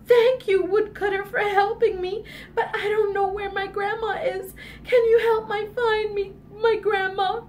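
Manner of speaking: put-on high little-girl plea; acted upset child asking for help; tearful pleading.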